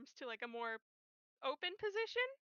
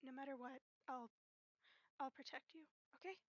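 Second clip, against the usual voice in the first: tender comforting; 7 dB quieter, faster than usual. Acted. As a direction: comfort a friend in a hug, softly. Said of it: "and he just goes".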